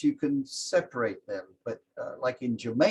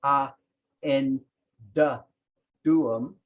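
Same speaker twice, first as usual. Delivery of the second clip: spaced out word by word; 4 dB louder, slower than usual. Shown as a demonstration. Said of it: "so it would be".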